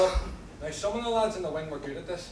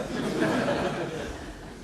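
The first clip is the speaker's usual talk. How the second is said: hearty laugh. Acted. Cellmate laughs at joke.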